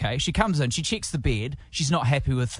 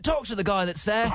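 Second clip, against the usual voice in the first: high, improvised British phone voice; put-on British accent; 7 semitones higher than usual. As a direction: imitate your fake British phone accent. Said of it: "So I went with a British".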